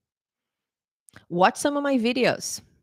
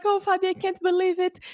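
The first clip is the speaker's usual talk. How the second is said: high, excited student voice; high-pitched mock squeal of joy; imitated delighted disbelief; 11 semitones higher than usual. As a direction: act out a thrilled student's amazement. Student's delighted reaction to good news.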